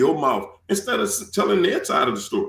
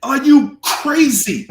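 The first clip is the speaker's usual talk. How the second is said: loud, higher, slow scolding yell; imagined angry scolding; 5 semitones higher, 5 dB louder than usual. Retold or imitated. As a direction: shake him awake with fury. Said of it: "yanked me up and said".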